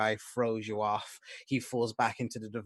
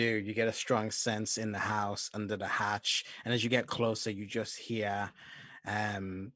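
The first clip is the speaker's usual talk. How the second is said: ordinary talk, nothing marked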